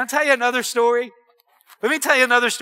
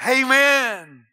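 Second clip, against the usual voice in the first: slow, ringing preacher's delivery; triumphant scripture declamation; 3 semitones higher, 5 dB louder, slower than usual. Real, not acted. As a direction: stretch out each phrase to a big finish. Declaims the psalm at full force.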